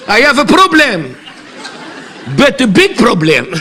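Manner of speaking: laughing through a put-on English accent; English accent, giggling; mock English accent. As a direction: play Shakespeare in broken English. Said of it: "cet accent".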